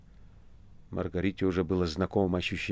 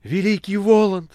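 much higher, louder rapturous exclamation; ecstatic cry; 12 semitones higher, 6 dB louder than usual. A narrator's reading. Margarita echoing the master, rapturously crying out. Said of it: "стала вторить ему".

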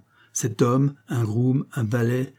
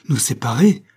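cried out; 2 semitones higher, 6 dB louder than usual. A narrator's reading. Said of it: "cria-t-il".

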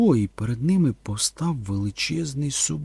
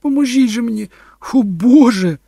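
high, loud cry for help; shrieking; 12 semitones higher, 9 dB louder than usual. A narrator's reading. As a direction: shriek in pain and panic. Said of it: "зойкнула Кетрін". (зойк відчаю)

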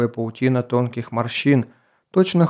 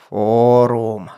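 put-on booming old voice; 3 dB louder than usual. A narrator's reading. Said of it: "неожиданно прогремел голос старца".

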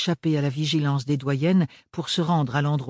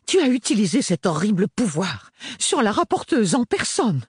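higher and louder, outraged; furious mother; 9 semitones higher, 5 dB louder than usual. A narrator's reading. Mother scolding Ophélie over the rapporteuse, outraged. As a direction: scold her for the scandal.

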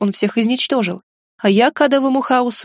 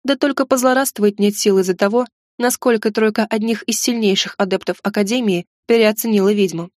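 usual speaking voice

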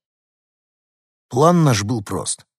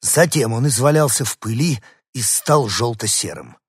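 ordinary delivery

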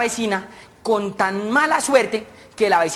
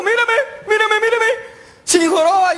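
muy aguda, entre risas; preséntalo orgulloso como niño; presumiendo feliz; 13 semitones higher than usual. Acted. Presume al amigo cojo. (presume con voz muy aguda)

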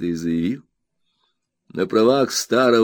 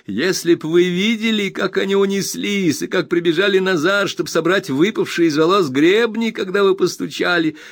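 high, bright-eyed friend overjoyed; eager Traddles; 9 semitones higher, 4 dB louder than usual. Acted. Traddles reassures his old friend Copperfield, eager high voice. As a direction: gush with happiness at the reunion.

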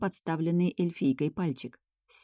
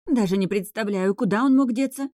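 higher, louder anxious exclaim; worried mother; 5 semitones higher, 5 dB louder than usual. Acted. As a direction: fret about the missing boy. Worried mother, lost son. (встревоженно, выше и громче)

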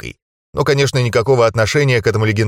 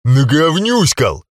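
angry bark; 3 semitones higher, slower than usual. Acted. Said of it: "рявкнул я".

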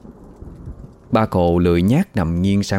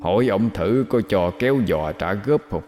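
muttering ghost whisper; 6 dB quieter than usual. Acted. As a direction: mutter to yourself like a lurking ghost. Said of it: "cái giọng cứ chui rút vào tai như ma quỷ".

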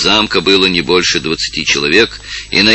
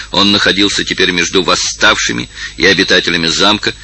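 ordinary delivery

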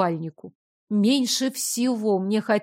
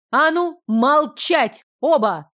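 higher, louder commanding bark; menacing bark; 5 semitones higher, 4 dB louder, slower than usual. Acted. Princess silences both quarrelling men, fierce bark. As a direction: bark the order to silence them. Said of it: "рявкнула грозно".

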